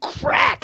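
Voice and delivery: sharp, high vocal crack effect; imitated club crack. Game master voices club hitting chin, sharp crack. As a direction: sound out the blow landing.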